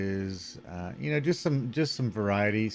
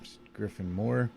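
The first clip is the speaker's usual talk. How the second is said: ordinary delivery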